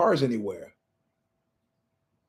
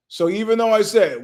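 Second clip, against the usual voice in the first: ordinary talk, nothing marked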